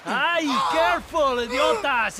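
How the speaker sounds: angry shout. Acted. Men handling injured man roughly.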